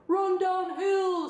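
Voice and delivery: drawn-out bovine suggestion; suggesting cows. A narrator's reading. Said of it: "suggested the cows".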